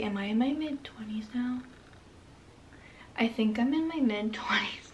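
ordinary talk

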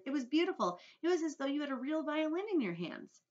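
put-on high, enthusiastic boy voice; James. A narrator's reading. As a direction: gush like a delighted child. Delighted boy praises the music.

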